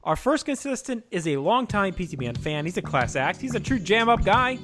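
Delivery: upbeat host intro; game show introduction. Acted. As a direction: hype up the contestant. Contestant introduction.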